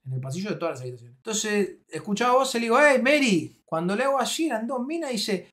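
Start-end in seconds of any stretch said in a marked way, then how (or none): 2.8-3.6 raised, higher call to a friend. Retold or imitated. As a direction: call out to get her attention. Calling out from behind curtain. (llamando en voz alta)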